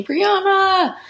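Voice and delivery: high, loud, drawn-out call of a name; imitated scream. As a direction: re-enact someone screaming for her from upstairs. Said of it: "he just came upstairs screaming".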